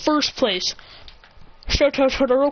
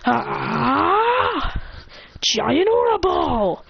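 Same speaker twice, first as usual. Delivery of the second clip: high, drawn-out anime power-up yell; big attack call; 6 semitones higher, much slower than usual. Acted. Hero leaping into the air, high drawn-out attack yell. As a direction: wind up and stretch out the move.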